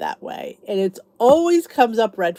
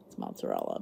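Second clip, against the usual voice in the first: ordinary delivery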